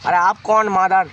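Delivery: ordinary delivery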